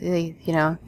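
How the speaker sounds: ordinary talk, nothing marked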